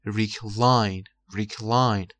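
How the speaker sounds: recline with the weight on CLINE; ri-CLINE, drawn on the second syllable; second-syllable stress. Shown as a demonstration. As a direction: hit the end of recline. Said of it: "فشارش رو قسمت دومشه".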